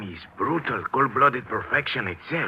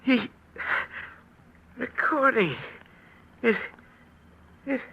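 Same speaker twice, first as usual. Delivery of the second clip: dying gasp; 13 semitones higher than usual. Acted. Dying man whispers his clue.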